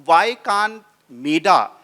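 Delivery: ordinary delivery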